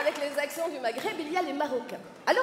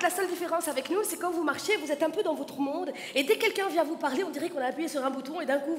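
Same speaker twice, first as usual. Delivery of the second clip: usual speaking voice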